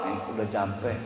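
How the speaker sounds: barely bother to answer; a drained husband mumbling he's tired; exhausted husband. Acted.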